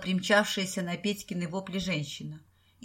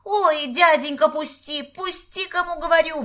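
high-pitched idiotic squeal; придурочный визг; 9 semitones higher, 7 dB louder than usual. A narrator's reading. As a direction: squeal like a fool begging to be let go. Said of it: "во всю мощь завизжал Петька совершенно придурочным голосом". (визгливо, придурочным голосом)

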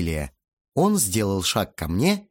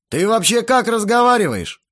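raised, high-pitched indignant voice; angry scolding; 9 semitones higher, 6 dB louder than usual. A narrator's reading. Older man berates his host's son, loud and indignant. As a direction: tell the boy off loudly.

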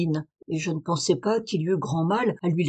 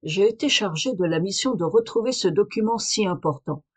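ordinary delivery

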